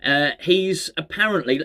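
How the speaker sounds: normal talking voice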